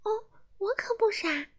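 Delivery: high elf voice, unbothered; high fairy voice; little elf. A narrator's reading. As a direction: brush off the insult lightly. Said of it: "小精灵说".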